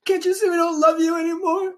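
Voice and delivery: high-pitched play-acted plea; mock heartbroken rejection. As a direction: send them away in a high put-on voice. Joins the acted eviction scene.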